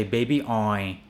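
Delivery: put-on baby oil as 'baby oi'; imitated learner's baby oi; dropped final L. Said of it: "People say".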